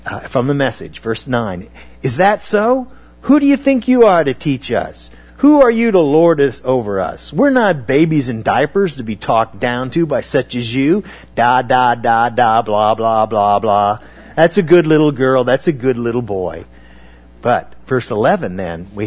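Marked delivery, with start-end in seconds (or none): 2.0-11.0 mock the prophet with contempt; indignant scoffing leaders; arrogant mocking retort. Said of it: "arrogant reply back to Isaiah". 11.3-16.6 sneering louder singsong da da blah blah; hammed-up scornful baby talk; mocking baby talk. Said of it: "arrogant reply back to Isaiah".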